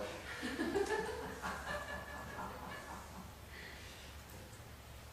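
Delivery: breathy, voiceless chuckling; real soft wheezing laughter; long laugh. Real, not acted. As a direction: laugh on and on, softly. Laughs after admitting getting depressed.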